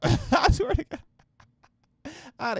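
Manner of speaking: cracking up mid-sentence; swearing to God through a burst of laughter; laughing. Real, not acted. Cracking up at his own rant.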